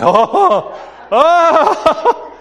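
high, laughing oh oh of wonder; half-laughing amazement at the screen; delighted gasp. Retold or imitated. Awed by the movie-mode picture.

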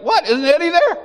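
very high, loud incredulous question; startled disbelief. Retold or imitated. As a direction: act out a shocked reply. Pastor's startled reply acted out.

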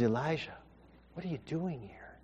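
soft, breathy whisper, God speaking close; gentle whisper. Acted. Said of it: "in a gentle whisper".

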